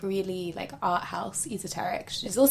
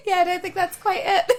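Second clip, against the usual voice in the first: helpless high laugh spilling into the words; 10 semitones higher, 6 dB louder than usual. Real, not acted. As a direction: laugh hard, then talk through it, high-pitched. Cracking up at failed accent.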